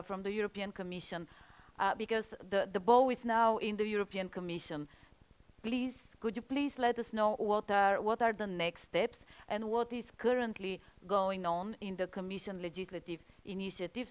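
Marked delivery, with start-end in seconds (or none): none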